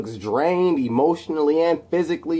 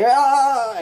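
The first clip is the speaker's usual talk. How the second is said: quick mock yell; 13 semitones higher, 5 dB louder than usual. Narrating the explosion.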